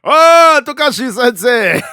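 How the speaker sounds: very high, loud hammed-up Japanese accent. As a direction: greet the sensei in an over-the-top Japanese accent. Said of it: "I don't know why I put the accent".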